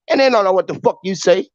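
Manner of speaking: ordinary talk, nothing marked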